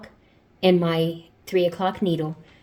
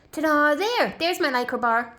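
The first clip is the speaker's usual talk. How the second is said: higher, louder reveal; bright ta-da reveal; 9 semitones higher, 3 dB louder than usual. Real, not acted. Showing her knitting bar, a proud ta-da. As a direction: present it like a magic trick.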